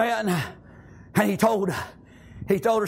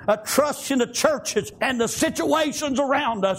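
rhythmic, driving revival preaching; full-force country revival sermon; fervent preaching; 5 semitones higher than usual. Real, not acted. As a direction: preach it in chanted bursts with gasped breaks.

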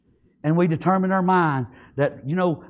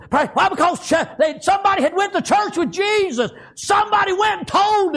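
very high, unbroken pulpit cry; frenzied preaching; 12 semitones higher than usual. Acted. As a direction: keep the sermon pitched at its peak. Preaching at full force.

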